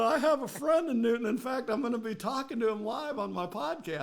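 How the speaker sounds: excited. Retold or imitated. Excited retold reply to customer.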